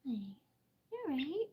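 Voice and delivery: soft high-pitched coo to a pet. Real, not acted. Checking on the bird.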